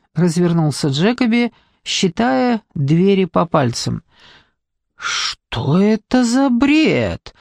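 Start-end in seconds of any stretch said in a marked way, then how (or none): 5.0-7.3 drawn-out, higher indignant question; закипающее возмущение. A narrator's reading. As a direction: stretch it out, starting to seethe. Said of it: "начал кипятиться Спейд". (возмущённо, протяжно, закипая)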